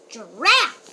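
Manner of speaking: loud straining grunt; effortful grunt. Acted. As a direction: heave like pulling hard. Mummy straining at the pumpkin.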